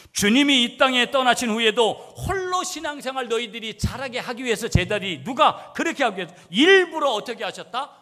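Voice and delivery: loud, high-pitched impassioned preaching; preaching at full force. Real, not acted. Preacher explains Jesus' training of disciples, at full force. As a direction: cry it out to the whole congregation.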